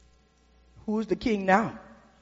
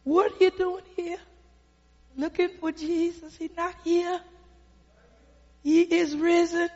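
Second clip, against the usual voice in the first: hushed, gentle angel voice; softly voiced angel; 6 semitones higher, 7 dB quieter than usual. Angel to the weeping woman, soft and hushed.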